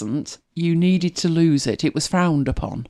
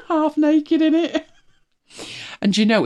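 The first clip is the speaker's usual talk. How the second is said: ordinary talk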